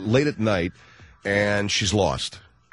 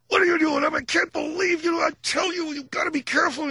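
very high mock scream. Husband screaming at lost wife on the phone, high mock scream. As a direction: screech at her in exasperation. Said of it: "I'm screaming".

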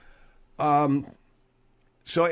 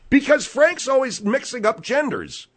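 loud, high-pitched retort; frustrated, raised-voice comeback; exasperated outburst; 10 semitones higher, 5 dB louder than usual. Acted. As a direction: argue back heatedly, voice jumping up. Snaps back in the quarrel.

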